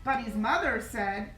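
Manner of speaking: ordinary delivery, nothing marked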